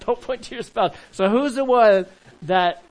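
laughing through the words. Real, not acted. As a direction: repeat it while still cracking up. Still laughing.

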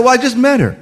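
high-pitched retold defense to mother; mock defensive. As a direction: play the son justifying himself, pitch up. Retold phone argument with mother.

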